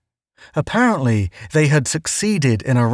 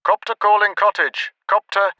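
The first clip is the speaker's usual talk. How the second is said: radio call; 8 semitones higher than usual. A narrator's reading. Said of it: "radio squawk".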